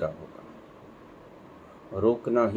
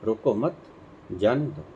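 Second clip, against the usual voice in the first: "रोको मत" together, pause, then "जाने दो"; pause after मत. Shown as a demonstration. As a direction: break the phrase after "मत", before "जाने दो". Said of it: "उसने अर्थ समझा". ("रोको मत" के बाद विराम, फिर "जाने दो")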